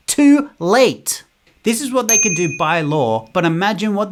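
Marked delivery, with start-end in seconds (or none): 0.1-1.2 too late, higher and louder; loud snap. Real, not acted. Mocking the listener, sudden loud retort. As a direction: bark it out, mocking. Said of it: "snapping punchline".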